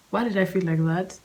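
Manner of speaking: ordinary delivery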